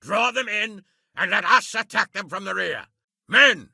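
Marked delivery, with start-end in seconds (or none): 3.3-3.7 sharp, loud rallying call to the troops. Acted. Commander calling his men before the battle, loud. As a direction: bark it out to get the men's attention.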